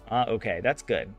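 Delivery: ordinary talk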